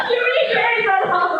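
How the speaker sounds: words breaking into a high laugh. Real, not acted. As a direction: talk through real high-pitched laughter. Friends cracking up on the livestream.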